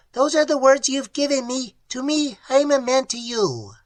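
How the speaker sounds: high, strange alien voice; alien creature. Acted. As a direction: speak like a clicking cave creature. Creature explains its words.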